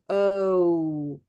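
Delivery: ordinary delivery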